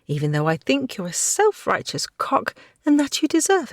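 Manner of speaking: a lightly voiced cheeky girl sneering through it; sarcastic young woman. Kirsten voiced high by narrator.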